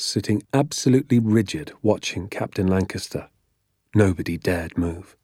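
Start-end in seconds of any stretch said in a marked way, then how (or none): none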